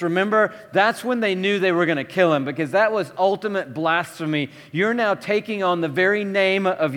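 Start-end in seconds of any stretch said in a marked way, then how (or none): none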